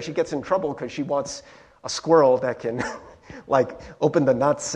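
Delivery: usual speaking voice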